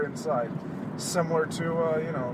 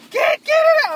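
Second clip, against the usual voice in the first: strained, high and louder; high frustrated cry; 10 dB louder than usual. Real, not acted. Host still stuck in coat, high frustrated cry. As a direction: squeeze it out, still fighting the coat.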